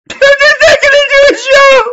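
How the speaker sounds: high, loud, laughing outburst; laughing shout. Real, not acted. Streamer joking about his broken camera, laughing shout. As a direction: blurt it out mid-laugh.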